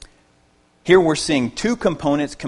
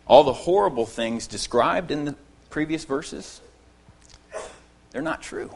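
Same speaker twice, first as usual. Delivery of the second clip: ordinary delivery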